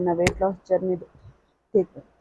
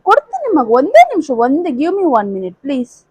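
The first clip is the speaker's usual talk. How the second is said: calling out to son; 6 semitones higher, 7 dB louder than usual. Real, not acted. Mother calls to fussing son. (ಮಗನಿಗೆ ಜೋರಾಗಿ ಕೂಗುವುದು)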